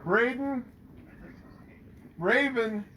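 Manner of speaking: ordinary delivery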